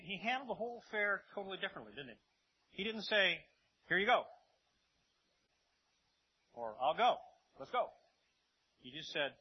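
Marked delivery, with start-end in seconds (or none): 3.9-4.3 louder, higher quick offer; breezy offer. Acted. Jesus imagined granting healing at once, breezy. Acts out a casual, eager reply. 6.8-7.9 loud, punchy readiness; eager agreement. Acted. Jesus imagined agreeing at once, eager. Acts out an eager instant yes.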